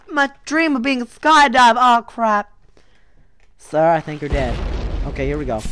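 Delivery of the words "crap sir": normal talking voice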